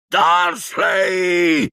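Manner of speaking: high, drawn-out furious shout; explosive roar at the uncle; Hagrid, exploding. A narrator's reading. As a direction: bellow the name, stretched out. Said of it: "sbottò".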